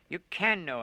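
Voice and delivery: loud, high desperate scream; screaming at him. Acted. Woman screams at the unmoved Lone, loud and high. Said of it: "I screamed at him".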